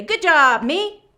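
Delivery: loud cheer. Shown as a demonstration. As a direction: cheer yourself on. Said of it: "Give yourself a pat and say".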